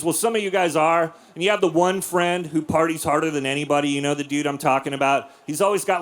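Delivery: ordinary talk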